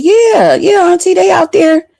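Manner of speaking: a hint of an eager nephew; imitating cousin. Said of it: "And he said".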